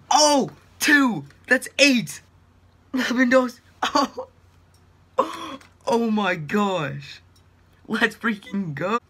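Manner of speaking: loud, high, laughing cheer; can't believe the luck, cheer; excited disbelief. Real, not acted. Eighth walkout, laughing disbelief.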